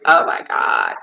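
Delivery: loud, hammed-up tearful wail; mock crying. Crying with a client over a lost pet, hammed-up sobbing. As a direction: wail along as if crying with them. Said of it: "I have cried with them".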